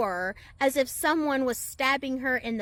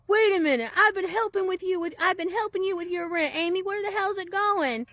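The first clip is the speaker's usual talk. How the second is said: higher, louder indignant man's voice; angry neighbour; 7 semitones higher, 3 dB louder than usual. Retold or imitated. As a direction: demand answers like a betrayed helper. Neighbour confronts Amy about rent.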